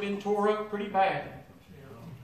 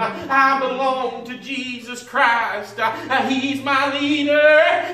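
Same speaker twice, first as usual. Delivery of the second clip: impassioned sermon building louder, with long cried-out openings; fervent preaching; 6 dB louder than usual. Real, not acted. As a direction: preach it out to the congregation, stretching key words. Preaches with rising fervour.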